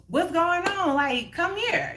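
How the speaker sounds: high-pitched mock come-on; lifted, playful imitation of beckoning; imitated man beckoning. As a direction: imitate a man calling someone over. Man beckons her over, retold.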